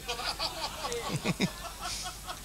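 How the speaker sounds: short breathy laugh after the punchline; chuckling at his own gag. Real, not acted.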